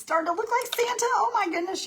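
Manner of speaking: high gleeful squeal over the Santa; bubbling over with joy, pitch way up; real delight breaking into a high squeak; excited. Real, not acted. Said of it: "I'm excited now".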